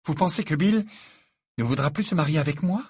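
lightly voiced imperious challenge. A narrator's reading. Fiancée demanding an answer from the groom's mother, imperious. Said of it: "demanda Fleur d'un ton impérieux".

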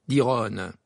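ordinary delivery

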